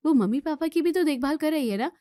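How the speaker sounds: ordinary talk, nothing marked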